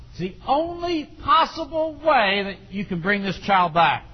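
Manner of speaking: loud, driving sermon voice; preaching. Acted. Preacher pressing parents on chastisement, loud and driving. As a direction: preach it hard to the congregation.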